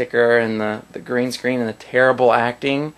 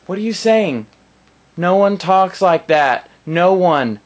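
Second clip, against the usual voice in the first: ordinary talk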